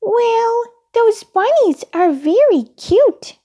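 high, loud puppet voice; very high childlike voice; Elmo. A narrator's reading. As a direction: speak up in a small high character voice. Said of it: "said Elmo".

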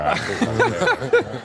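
a laughing hint of a posh English accent. Shown as a demonstration. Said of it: "very like that".

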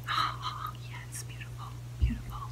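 soft unvoiced whisper; hushed whisper over a new hair clip; whispered aside. Real, not acted. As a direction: whisper it to yourself while trying on.

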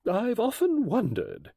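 slow, high musing admission; puzzled honesty, high and slow; truthful. A narrator's reading. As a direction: admit it candidly, drawn out. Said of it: "said Missus Oliver truthfully".

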